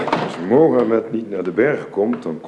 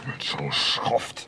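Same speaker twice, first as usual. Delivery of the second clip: contempt; 8 dB quieter, much slower than usual. Acted. Jealous quarrel, contemptuous remark.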